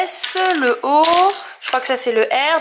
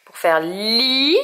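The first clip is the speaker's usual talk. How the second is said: syllable li stretched out very slowly; sounding out li for a toddler; 3 semitones lower, much slower than usual. Shown as a demonstration. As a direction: draw out li for the child. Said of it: "on met le I".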